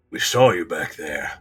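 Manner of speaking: low accusing snarl; menacing pursuer, pitched low. Acted. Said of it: "one of them snarls".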